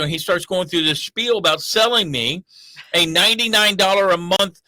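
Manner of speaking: ordinary talk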